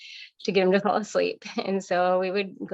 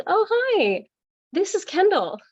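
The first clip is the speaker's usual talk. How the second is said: much higher, louder sing-song hello; bubbly aunt greeting; 12 semitones higher, 4 dB louder than usual. Retold or imitated. Aunt introducing her niece to the trainer, bright high greeting. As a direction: chirp the introduction like an eager matchmaking aunt.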